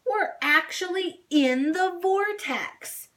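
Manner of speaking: high, slow, awed exclaiming; amazed. A narrator's reading. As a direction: savor being inside the vortex. Doll thrilled in tornado.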